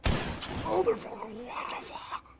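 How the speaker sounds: high breathless whispered shock; startled whispering shriek. Real, not acted. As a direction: gasp it out in fright. Startled by enemy.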